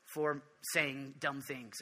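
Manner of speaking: low, soft, slowing prayer of repentance; murmured confession, dragging and low; sheepish confession. Retold or imitated. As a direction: imitate a resigned, repeated apology to God. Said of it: "every time I'm like".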